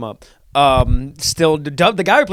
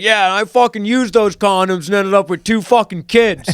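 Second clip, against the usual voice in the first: higher, brash acted interjection; interjecting; 5 semitones higher, 3 dB louder than usual. Voice actor interjects about the Christmas gift, brash and raised. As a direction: butt in with a raised, punchy voice. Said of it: "he interjected".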